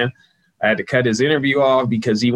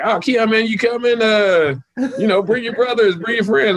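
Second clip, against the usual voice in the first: much higher, hyped-up party invite; T O inviting; 10 semitones higher than usual. Retold or imitated. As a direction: sell the invite like a host who wants everyone there. Voicing T O inviting him out.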